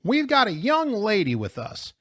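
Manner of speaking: high-pitched chiding call. A narrator's reading. Mrs. Zimmerman warning the foul-mouthed driver, calling out. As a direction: call it up pointedly. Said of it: "Missus Zimmerman called".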